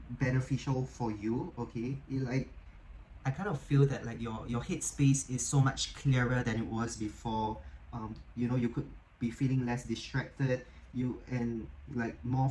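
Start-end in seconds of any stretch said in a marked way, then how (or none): none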